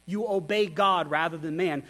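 ordinary talk

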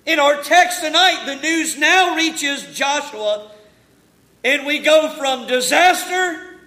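emphatic pulpit storytelling that builds; forceful preaching. Real, not acted. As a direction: preach it out to the congregation, building in volume and pitch.

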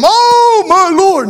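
high, loud squeal of alarm; a big man squeaking in high-pitched panic; panicked Brother Jim. Retold or imitated. The big pastor squeals when the knife comes out, high and loud.

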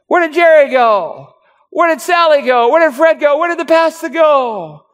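high, confused calls into an empty church; bewildered churchgoer. Acted. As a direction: play a lost churchgoer looking around for everyone. Congregation finds church empty.

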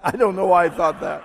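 big, amused disbelief at yourself; incredulous exclamation. Real, not acted. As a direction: burst out over the crowd's laughter. Exclaims at own absurd thought.

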